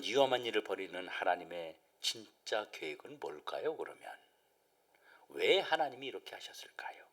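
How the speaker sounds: soft unvoiced whispered question; hushed question. Real, not acted. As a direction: whisper the question to draw them in.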